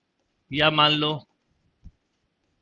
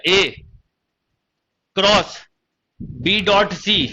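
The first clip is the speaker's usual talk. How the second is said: long pauses around "cross", then a slow "बी डॉट सी"; slow demo with "बी डॉट सी" as one drawn-out group; misgrouped reading; much slower than usual. Shown as a demonstration. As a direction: read it wrongly, cutting "cross" off from "बी". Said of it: "गलती से ऐसा read कर गया".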